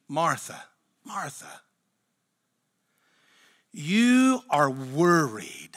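with feeling. Retold or imitated. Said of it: "He reads with such feeling".

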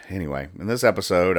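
normal talking voice

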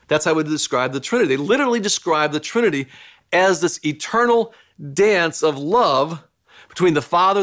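ordinary talk, nothing marked

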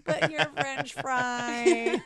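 slow, drawn-out mock whine; hammed-up last plea, stretched out; forlorn plea.

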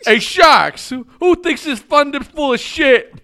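loud excited call. Real, not acted. Excited shouted greeting.

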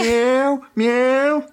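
high-pitched fake meows; bad cat impression. Gatomon panics and pretends to be a normal cat, high fake meowing. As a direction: meow unconvincingly, pitched well up. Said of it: "pretends to be a normal cat".

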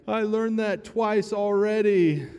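put-on high, slow, teary kid voice; a little boy sniffling out his answer; child through tears. Dad retells his crying son's reply, high and slow. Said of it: "through his tears".

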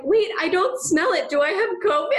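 high alarmed mock voice, laughing; high half-laughing alarmed voice; worried Monica. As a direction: fake panic over losing smell. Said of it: "Monica's like".

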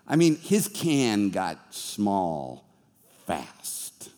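a preacher's voice dwindling to nothing to show the shrinking; dramatic drop. Acted. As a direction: let the voice shrink away on fast.

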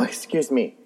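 usual speaking voice

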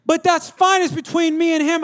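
put-on high, insistent teenage retort; acted indignant son; protesting son. Acts son arguing back.

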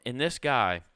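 ordinary talk

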